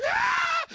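high, loud imitated scream; shouted scream. Friend's stewardess screaming on a shaking plane, shrill imitated scream. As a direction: mimic a panicked passenger's scream. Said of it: "somebody started shouting".

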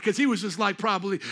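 normal talking voice

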